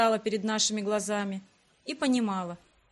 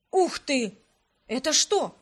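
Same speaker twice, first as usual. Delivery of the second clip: excited exclaim; 3 semitones higher, 4 dB louder, slower than usual. A narrator's reading. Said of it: "весело воскликнул Арман".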